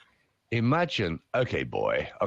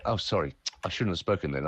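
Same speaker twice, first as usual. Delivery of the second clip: usual speaking voice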